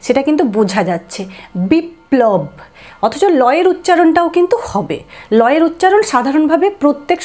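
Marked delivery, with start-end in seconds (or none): 1.7-2.4 "বিপ্লব" split bip-plob on a held p; doubled p. Shown as a demonstration. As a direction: make both p's heard in "বিপ্লব". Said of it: "যে দুবার উচ্চারণ".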